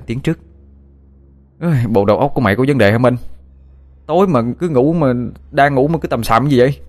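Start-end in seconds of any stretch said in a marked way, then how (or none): 1.6-6.8 annoyed scolding. Acted. Woken friend scolds Minh.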